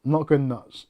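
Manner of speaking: normal talking voice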